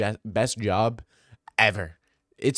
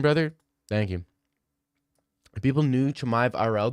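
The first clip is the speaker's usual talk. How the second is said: ordinary talk, nothing marked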